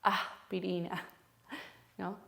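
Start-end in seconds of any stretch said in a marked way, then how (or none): 0.0-1.1 "aspirina" slowly, with an h-like breath for the s; aspirated S, slowly shown. Shown as a demonstration. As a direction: exhale in place of the s in "aspirina".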